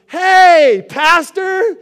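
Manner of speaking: loud, high mock greeting across the shop; calling out. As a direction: shout it across a garage like a happy mechanic. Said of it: "the guy goes".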